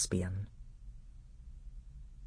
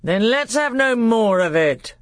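loud, high-pitched royal outburst; furious uncle closing the matter; angry; 9 semitones higher, 6 dB louder than usual. Acted. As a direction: shout the boy down. King ends the scolding angrily.

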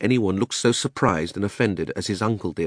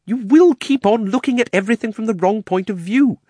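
high, loud, exasperated uncle; impatient; 14 semitones higher, 6 dB louder than usual. A narrator's reading. Uncle lectures his nephew on the experiment, impatient. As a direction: scold with mounting impatience, pitched high. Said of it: "with a look of impatience".

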